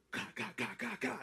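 rapid "ka" gunshot noises; imitated gunfire. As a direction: mimic shots going off with your mouth. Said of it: "you just hear".

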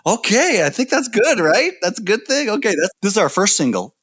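put-on playfully baffled young musicians; unsure agreement. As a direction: talk yourself into it, high and tentative. Band's baffled reaction, acted out.

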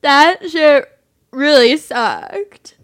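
put-on loud, slow drunken wail. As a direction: drag it out like a drunk. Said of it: "I'll just do my drunk voice".

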